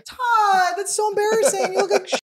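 high, loud, helpless laugh-crying; cracking up so hard it turns to tears; crying with laughter. Real, not acted. Wife sees husband's photo, laughing until she cries. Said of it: "Already feel sad because you're crying".

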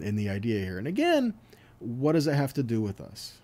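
usual speaking voice

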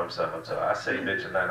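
ordinary talk, nothing marked

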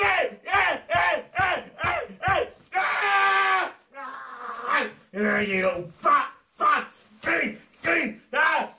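very high, strained screaming rant; frenzied rage. Acted. Attacker beats the sheep meant as Marcus, high frenzied screaming.

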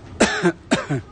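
sudden harsh coughing fit after swallowing a fly; choking cough. Real, not acted. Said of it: "excuse me while I choke".

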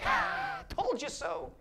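high, breathy smug scoff; smug told-you-so. Retold or imitated. Preacher voicing a smug, scoffing Jesus he rejects. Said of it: "the look of Jesus was like".

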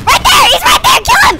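high, loud battle callout; urgent shouting. Real, not acted. Dad pointing out an enemy to son, urgent yelling. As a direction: scream the target's position.

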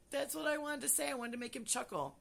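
way up high, squeaky and excited. Acted. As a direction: squeal it with giddy delight. High squeaky after plan.

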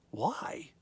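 hushed whisper; whispered why. Acted. Preacher whispering the question to the congregation. Rhetorical hush after "than ever.

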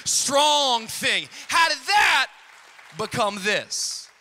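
loud sermon crescendo rising to a shout; impassioned preaching. Real, not acted. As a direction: promise it with full pulpit force. Preaches at full force to climax.

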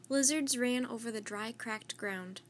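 usual speaking voice

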